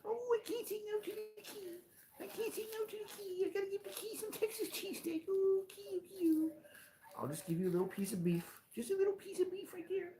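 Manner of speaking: very high, soft cooing pet voice; baby talk to cat. Acted. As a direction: coo at the kitty like a baby.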